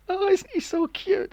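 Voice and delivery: very high, slow cooing; hammed-up aunty baby talk; gushing aunty. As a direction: coo over a cute child in a squeaky voice. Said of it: "around the living room going".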